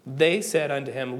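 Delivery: ordinary talk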